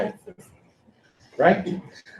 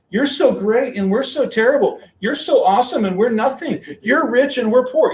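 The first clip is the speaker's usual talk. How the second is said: sarcastic; 8 semitones higher than usual. Retold or imitated. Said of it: "then he gets really sarcastic".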